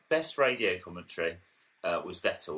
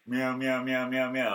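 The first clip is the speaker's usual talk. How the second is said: imitated engine noise; 3 semitones higher, 4 dB louder, slower than usual. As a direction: rev up the meows in a rising string. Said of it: "everyone else is going meow".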